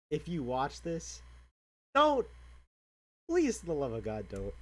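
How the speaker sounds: loud, high, cracking plea; desperate plea. Real, not acted. To viewers thinking of suicide, a loud, high desperate plea. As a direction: beg them with everything you've got.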